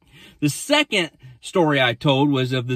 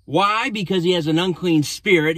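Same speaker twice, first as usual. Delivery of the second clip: usual speaking voice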